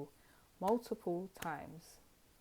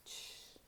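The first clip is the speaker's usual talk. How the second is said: shush; 14 dB quieter than usual. Shown as a demonstration. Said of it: "you just went".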